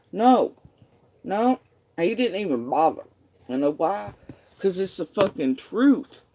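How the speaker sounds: furious certainty; angry outburst. Real, not acted. Telling the viewer he won't deny it, loud and furious. Angry answer to rhetorical question.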